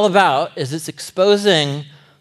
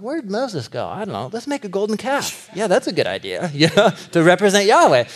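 higher, airy mock chatter of the Israelites; 4 semitones higher than usual. Preacher mocking Israelites planning golden calf, airy and high. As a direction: play the clueless crowd cooking up a bad idea.

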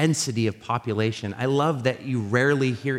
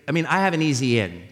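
ordinary talk

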